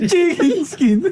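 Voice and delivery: high, giggly echo of the joke; laughing. Real, not acted. Friend echoing the chicken skin joke, laughing. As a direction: repeat it while cracking up.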